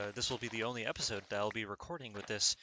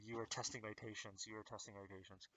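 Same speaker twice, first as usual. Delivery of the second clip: low, much softer, holding back anger; strained calm; 3 semitones lower, 16 dB quieter than usual. Real, not acted. Fed-up gamer to villager, quietly seething. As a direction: swallow the anger and say it very softly. Calming down after rant.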